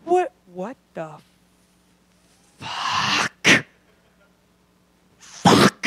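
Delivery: breathy, dawning horror on waking; hungover horror. Retold or imitated. Waking beside a one-night stand, a horrified breathy fuck. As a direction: realize with dread what you did. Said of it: "you wake up and you're like".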